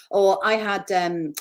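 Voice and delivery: ordinary talk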